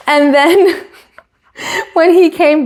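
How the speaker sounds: high-pitched, slow words through real laughter; laughing. Real, not acted. Host retells Toto's camera prank, laughing. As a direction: keep cracking up while telling it.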